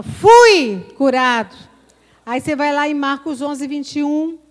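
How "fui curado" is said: slow, loud proclamation of healing; bold declaration. Shown as a demonstration. Preacher shows the flock how to declare. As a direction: declare it slowly and loudly.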